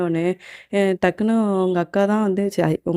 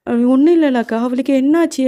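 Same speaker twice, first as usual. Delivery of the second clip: louder, higher worried insistence; insistent concern; 6 semitones higher, 5 dB louder than usual. Acted. Uncle asking his sister what happened to the girl, insistent. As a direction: push back, voice raised in concern.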